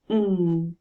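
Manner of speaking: Ng hummed through the nose; sustained Ng, no letter names; isolated ng sound. Shown as a demonstration. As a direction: hold Ng as one nasal sound. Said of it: "look at the second syllable".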